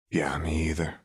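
hushed near-whisper reply; quietly. A narrator's reading. Vi whispering her agreement to Caitlin at the dance. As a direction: answer softly, almost breathless, barely voiced. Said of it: "Vi replied quietly".

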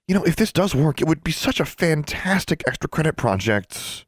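bubbling over with delight; excited Sonia. Acted. Sonia gushes to Alexandra about the ritual, high and thrilled.

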